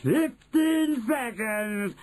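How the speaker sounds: high, long-drawn countdown call; very slow, high-pitched witch announcement; Grand High Witch crying out. A narrator's reading. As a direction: stretch the words out in a witchy cry. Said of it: "cried the Grand High Witch".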